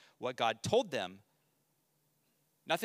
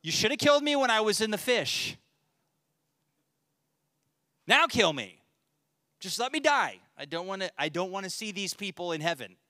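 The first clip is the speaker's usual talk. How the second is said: higher, louder, petulant whine; sulky death wish; 5 semitones higher, 4 dB louder than usual. Retold or imitated. Jonah sulking at God, loud whining plea. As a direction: throw a tantrum, begging to die. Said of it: "he's like".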